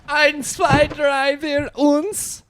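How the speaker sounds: very high, loud German counting; hammy falsetto German accent. Acted. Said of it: "[name]'s German accent".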